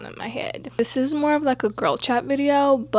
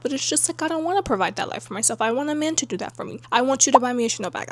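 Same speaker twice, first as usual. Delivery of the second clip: ordinary talk, nothing marked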